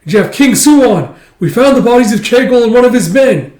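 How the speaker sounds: shouted high and loud, slowing on the names; urgent shout. Retold or imitated. Soldier shouting his report to King Suwon, loud and urgent. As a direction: call out the news to the king across the camp.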